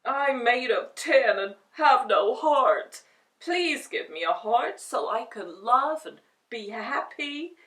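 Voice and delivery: Tin Man pleading; begging. A narrator's reading. Tin Man begs the beast for a heart. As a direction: beg for a heart. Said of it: "he begged".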